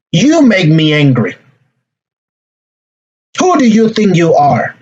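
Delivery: mock angry accusation, pitch jumping on "who"; aggressive. Shown as a demonstration. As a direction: demonstrate a confrontational outburst. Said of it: "that's going to be aggressive communication".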